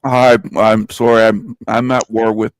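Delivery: talking with a mouthful; mouth full. Real, not acted. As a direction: speak through a bite of food. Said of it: "I got my mouth full".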